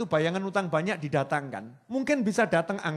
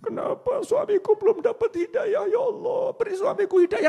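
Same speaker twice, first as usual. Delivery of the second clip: high, wailing tearful plea to Allah; wife weeping to Allah for her husband's guidance; wife's crying prayer; 4 dB louder than usual. Retold or imitated. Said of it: "nangis, oh".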